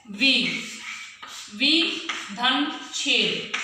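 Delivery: वी धन छेद said very slowly, part by part; slow dictation. Shown as a demonstration. Teacher dictating the next example to pupils, very slowly. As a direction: dictate it slowly, as if writing on the board. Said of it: "एक और उदाहरण मैं आपको दे रही हूँ".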